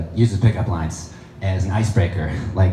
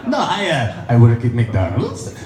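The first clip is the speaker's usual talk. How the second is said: put-on Russian accent; slower than usual. Russian astronaut lying about his job, Russian accent. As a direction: play dumb in a Russian accent. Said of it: "The Russian guy is like".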